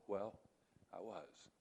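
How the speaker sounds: very soft, ashamed, barely getting it out; shamed quiet admission. Retold or imitated. Student confessing to his teacher, ashamed and very soft. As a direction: mumble it like someone who can't look up.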